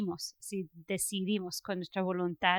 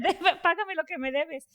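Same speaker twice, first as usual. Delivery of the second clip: higher, laughing imitation of a harsh creditor; laughing mock demand; 8 semitones higher than usual. Retelling the parable's servant demanding payment, laughing mock demand. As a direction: plays the choking servant half in jest, laughing. Servant choking his debtor, retold.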